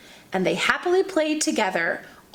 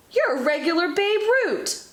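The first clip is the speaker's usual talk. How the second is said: yelled; 8 semitones higher than usual. Acted. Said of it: "Pointy yelled".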